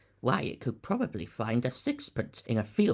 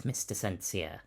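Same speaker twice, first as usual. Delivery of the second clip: usual speaking voice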